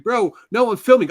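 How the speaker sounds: high, exasperated yell at a friend; retold yell. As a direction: mimic yelling at your picking buddy. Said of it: "I had to yell at him".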